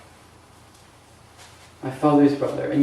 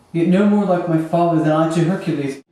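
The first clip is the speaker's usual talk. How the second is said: loud, impassioned contempt; scornful outburst; 3 semitones higher, 6 dB louder than usual. Acted. Hamlet comparing his uncle to his dead father, scornful outburst. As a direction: hurl the comparison out loudly.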